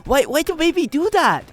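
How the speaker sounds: put-on high, loud, slowing old lady broken English; grandma amazed by AI baby videos, high and halting; imitating grandma. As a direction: play a baffled grandmother squeaking her question. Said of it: "she's like".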